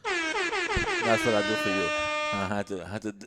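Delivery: loud, blurted out; talking over everyone, loud. Real, not acted. Kid on the podcast turns loud, friend asks why. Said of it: "Why are you so loud?".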